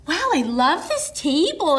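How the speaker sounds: lightly hammed-up fake-nice compliment; mock gushing. Daughter-in-law really plays nice at dinner, mock gushing. Naomi playing nice, voiced.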